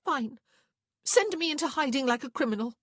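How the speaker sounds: high, loud, irritated retort; irritable snap. A narrator's reading. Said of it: "she snapped irritably".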